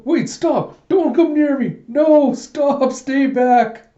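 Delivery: high, loud mock shrieking; hammed-up terror, fending them off at the top of the range; panicked pleading. [name] fends off the bugs.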